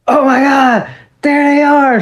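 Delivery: put-on high, thrilled squeal of a teen fan; super excited. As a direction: squeal like a sixteen-year-old spotting his heroes. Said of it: "I'm like".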